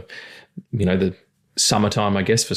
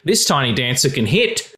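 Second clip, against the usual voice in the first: ordinary talk, nothing marked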